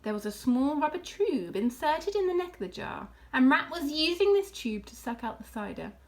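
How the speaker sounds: ordinary delivery, nothing marked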